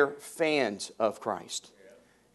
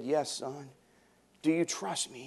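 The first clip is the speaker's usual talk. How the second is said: soft, calm heavenly voice; 8 dB quieter than usual. Retold or imitated. God answers the dangling man, soft and calm. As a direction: speak gently from above. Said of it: "he heard a voice from the heavens".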